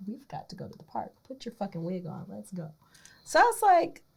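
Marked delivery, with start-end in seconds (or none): 0.1-2.7 much softer, quiet hurry; softly voiced eager boyfriend; hushed urgent boyfriend.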